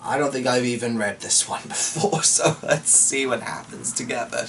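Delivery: plain reading voice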